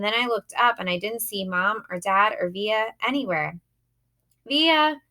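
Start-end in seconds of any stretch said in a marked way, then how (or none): 4.5-5.0 high, loud call into the crowd; yelling out. A narrator's reading. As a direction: search for her over the noise. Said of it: "I yelled out".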